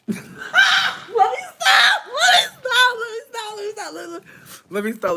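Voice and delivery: high, loud laughing shriek, fading into chuckles. Real, not acted. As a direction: squeal it out through real laughter, then calm down. Reader bursts out laughing at herself.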